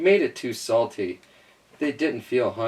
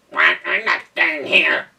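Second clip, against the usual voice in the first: wailing duck; 5 semitones higher, 5 dB louder than usual. A narrator's reading. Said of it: "wailed the duck".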